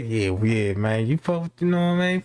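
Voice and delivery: low mocking voice of the coworker; a hint of a low smug rival; [name]. Mocking his coworker rival's smooth talk, low voice. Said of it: "he all that".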